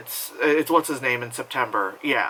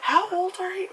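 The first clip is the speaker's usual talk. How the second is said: high mock question. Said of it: "teasing herself about age".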